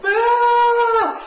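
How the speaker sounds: put-on shrill, very high, loud girlish scream. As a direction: shriek like a terrified little girl. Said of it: "he screams like a three-year-old girl".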